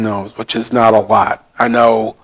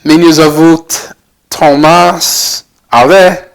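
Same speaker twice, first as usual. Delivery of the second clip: slow, higher-pitched beginner's Ukrainian, American accent; 4 semitones higher, 3 dB louder, slower than usual. Real, not acted. Learner introducing himself after twelve lessons, slow American-accented Ukrainian. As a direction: pick through the Ukrainian words slowly, American accent. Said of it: "a little bit not Ukrainian".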